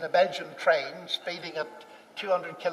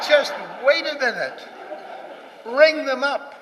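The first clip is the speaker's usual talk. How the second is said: higher and louder, laughing through it; laughing retelling; 7 semitones higher, 7 dB louder than usual. Retold or imitated. Husband laughingly replays his wife's command, higher and louder. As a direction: laugh while replaying the wife's firm order. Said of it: "one of those irresistible commands".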